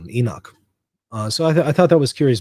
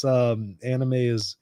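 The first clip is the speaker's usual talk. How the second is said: usual speaking voice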